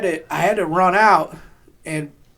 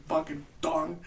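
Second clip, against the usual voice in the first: ordinary talk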